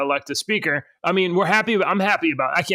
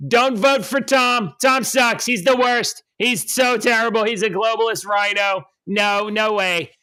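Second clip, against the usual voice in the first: higher, slow impression of a politician's rant; Donald Trump; 6 semitones higher, slower than usual. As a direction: draw out each put-down in a put-on politician's voice. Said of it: "and Trump said".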